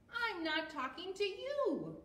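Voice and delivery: high-pitched acted huff; mad. A narrator's reading. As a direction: huff it high, right up to the last word. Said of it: "they're mad at each other".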